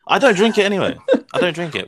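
self-justifying. Real, not acted. Accused friend defending himself over tea, laughing self-justification. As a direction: protest lightly through a laugh, making it sound normal. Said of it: "did you hear the way he said that, trying to justify it".

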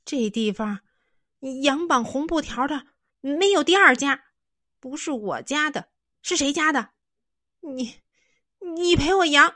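high, loud, tearful demand; boy demanding his sheep back; angry protest. Acted. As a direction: shout back through rising tears. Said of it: "反驳道".

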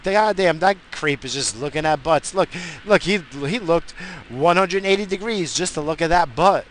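mock-shocked office gossip; gossiping coworker. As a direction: gasp at the creep like a nosy colleague. Imagined coworkers gossiping about him.

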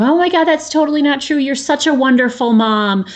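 high, fast, gushing reassurance; mock a friend rushing to deny it; gushing girlfriend. Imitating a girlfriend brushing off a friend's guilt, high and gushing. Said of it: "one of my girlfriends says something".

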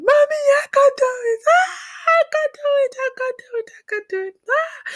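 very high, wailing mock scream. Daughter begging mother over the chicken, screaming. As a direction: squeal the plea in panic, starting loud. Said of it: "And I'm just screaming".

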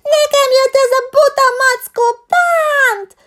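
very high, loud squealing complaint; shrieking fake victim. A narrator's reading. The fox girl squeals loudly that the bear hurt her. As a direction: shriek to fool the teacher. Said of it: "visítani kezdte".

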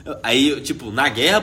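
normal talking voice

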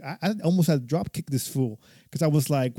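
ordinary talk

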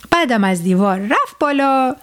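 ordinary delivery, nothing marked

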